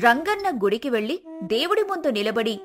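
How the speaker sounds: ordinary delivery, nothing marked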